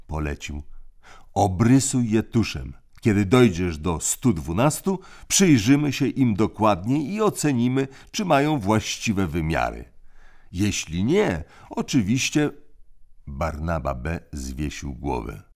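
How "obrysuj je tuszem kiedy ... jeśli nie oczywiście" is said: put-on raised, commanding boy's voice; crisp orders from the eldest; bossy older brother. A narrator's reading. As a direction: lay down the rules like a little tyrant. Tim giving orders.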